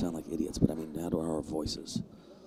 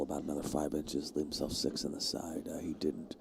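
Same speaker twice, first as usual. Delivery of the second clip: whispering; 4 semitones lower, 10 dB quieter than usual. Real, not acted. Hushed near players.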